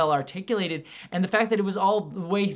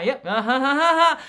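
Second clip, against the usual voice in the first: mock laugh; 9 semitones higher, 9 dB louder than usual. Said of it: "It's just like".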